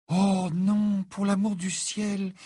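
high-pitched, drawn-out cry of dismay; exclaimed dismay. A narrator's reading. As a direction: cry out high, stretching the words. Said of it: "s'écria-t-elle". (cri consterné, aigu et étiré)

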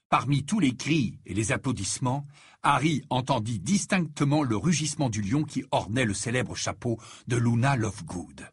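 plain reading voice

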